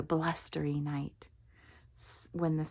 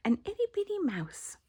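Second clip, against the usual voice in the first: tiny mouse; 13 semitones higher, faster than usual. A narrator's reading.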